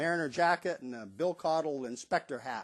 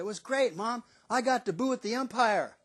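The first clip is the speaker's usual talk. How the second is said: put-on excited kid; 7 semitones higher, 4 dB louder than usual. Child voice, retold.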